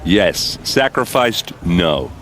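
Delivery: ordinary talk, nothing marked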